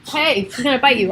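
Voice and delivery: ordinary talk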